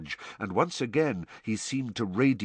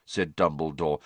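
plain reading voice

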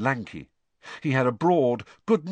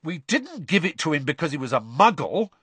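higher-pitched, indignant protest; 6 semitones higher than usual. A narrator's reading. Fred defending himself to his father, indignantly. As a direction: defend yourself as if wrongly accused. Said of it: "said Fred indignantly".

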